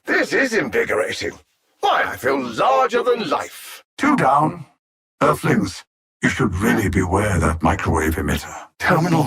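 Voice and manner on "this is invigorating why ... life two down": rising, much higher exultant cry; gleeful gloat. Acted. Alien villain revelling in his own power, exultant and high. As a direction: swell with triumph into a near shout.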